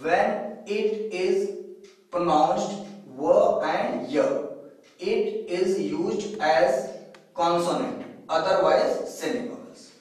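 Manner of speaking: unhurried repeat of the rule, word by word; slow dictation again. Shown as a demonstration. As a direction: lay the rule out slowly so students copy it. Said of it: "फिर से देखें". (दोबारा धीरे-धीरे बोलकर लिखवाना)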